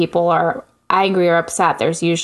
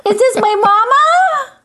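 put-on a high baby voice crying for mom; 12 semitones higher than usual. Shown as a demonstration. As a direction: whine for your mommy like a toddler. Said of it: "I do answer the phone like this".

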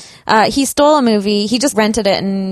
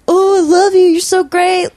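gushing fan; 9 semitones higher, 6 dB louder than usual. Retold or imitated. Fans gushing at celebrities.

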